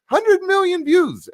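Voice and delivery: high, slow, drawn-out disbelief; hundred million views in a soaring high voice; amazed. Real, not acted. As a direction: stretch it out in awe. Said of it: "and it was insane".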